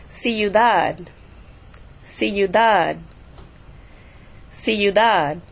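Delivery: "Ciudad" with DAD stressed, falling at the end; stress on last syllable. Shown as a demonstration. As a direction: land the weight on the "dad" of "Ciudad". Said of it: "I'm putting the stress on the dad".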